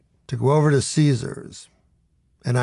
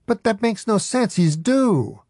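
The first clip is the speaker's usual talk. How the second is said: high, baffled insistence; lightly voiced stubborn sidekick; protest; 9 semitones higher than usual. A narrator's reading. Adam Eget protests.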